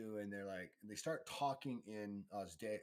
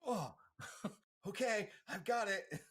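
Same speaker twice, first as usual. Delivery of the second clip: higher, louder mock voice; a high, hammed-up Roca; mock eager NPC; 10 semitones higher, 5 dB louder than usual. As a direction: play the overeager little Roca. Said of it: "it's like".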